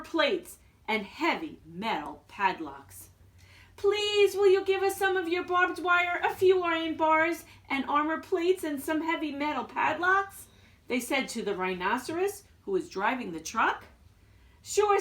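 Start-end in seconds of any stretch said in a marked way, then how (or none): 3.8-10.5 high, eager little voices; pleading little wolves. Acted. Wolves politely beg the rhinoceros, high and eager. 14.6-15.0 cheerful rhino. Acted. Rhinoceros agreeing.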